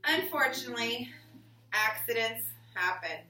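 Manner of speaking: put-on reading to children. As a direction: read it to the class like a picture book. Reading picture book aloud.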